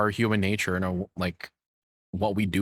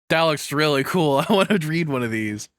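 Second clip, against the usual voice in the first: higher and louder, bubbling over with a laugh; thrilled; 6 semitones higher, 4 dB louder than usual. Real, not acted. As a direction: gush about it, laughing with delight. Streamer thrilled by book titles.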